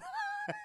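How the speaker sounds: high giggle; laugh. Real, not acted. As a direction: crack up.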